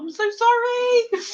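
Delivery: high, squeaky-pitched apology; emotional apology. Real, not acted. Apologising to friends she made cry, high-pitched apology. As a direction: let the apology climb right up high.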